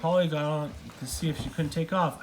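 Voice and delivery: usual speaking voice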